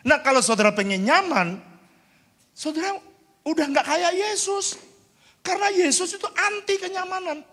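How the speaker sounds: high, loud preacher's warning; full-force preaching. Real, not acted. Preacher warning believers against comfort, high full-force preaching. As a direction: push the pitch right up and preach it hard.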